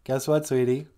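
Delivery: usual speaking voice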